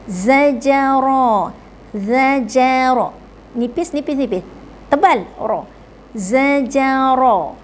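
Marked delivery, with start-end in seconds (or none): 0.1-3.1 slow. Shown as a demonstration. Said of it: "satu-satu".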